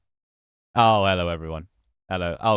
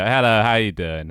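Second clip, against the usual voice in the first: excited greeting; 5 dB louder than usual. Real, not acted. Streamer greeting everyone once the screen works, excited. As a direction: burst into a big hello.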